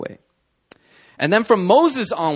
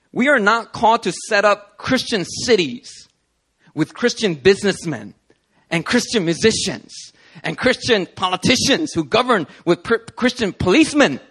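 rising, louder sermon build; growing preacher fervour; preaching; 8 semitones higher, 4 dB louder than usual. Real, not acted. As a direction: build the sermon toward passion.

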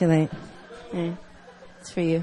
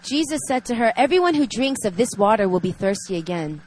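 reading scripture; 4 dB louder than usual. Acted. Reads scripture passage aloud.

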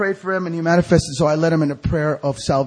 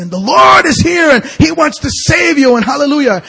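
high, loud imitation of a preacher; imitated revival-meeting shout; fiery street preaching; 9 semitones higher, 8 dB louder than usual. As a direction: belt it out like a fired-up evangelist. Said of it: "he's just preaching".

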